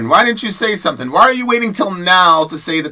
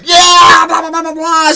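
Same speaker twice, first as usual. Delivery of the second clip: high, loud whooping cheer; cheering crowd; 8 dB louder than usual. Retold or imitated. Friends cheering her on at graduation, wild cheer. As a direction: erupt like a rowdy cheering section. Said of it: "we were like".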